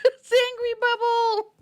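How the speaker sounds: very high, drawn-out laughing squeal; shrieking laughter. Real, not acted. Collector finding Angry Bubble in the box, laughing shriek. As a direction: squeal it through helpless laughter. Laughing at the toy she got.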